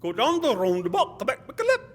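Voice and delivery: high, loud burbled directions; imitated local man. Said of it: "Say it again a little slower".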